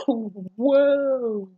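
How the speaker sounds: loud, high startled whoa; surprised. Real, not acted. Reader startled by the forced kiss. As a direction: gasp out a shocked whoa.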